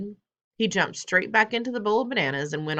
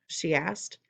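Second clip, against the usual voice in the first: ordinary delivery, nothing marked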